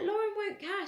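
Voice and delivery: high, insistent mock-mum voice; a mother telling her daughter off, in a high imitated voice; mum's exasperated call. Mum scolding her decision.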